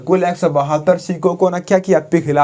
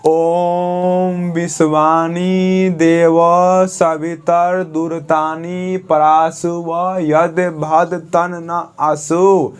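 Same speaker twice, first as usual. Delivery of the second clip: very slow chanted mantra; slow recitation; much slower than usual. Shown as a demonstration. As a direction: draw out each syllable of the mantra. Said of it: "इसको हम fast में बोलेंगे तो बोल नहीं पाएंगे".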